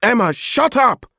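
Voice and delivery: high, exasperated shout; angry yell. A narrator's reading. Uncle snaps at crying child.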